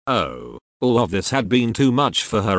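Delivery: ordinary delivery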